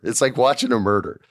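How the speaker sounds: usual speaking voice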